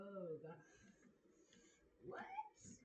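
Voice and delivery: ordinary delivery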